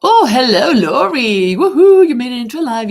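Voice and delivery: loud, high, delighted hello; excited greeting. Real, not acted. Greeting Lori as she joins the livestream, loud and thrilled. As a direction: call out to her like a welcome friend. Greeting a viewer joining the live.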